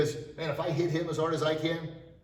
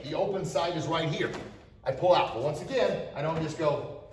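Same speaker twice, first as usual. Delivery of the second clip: ordinary talk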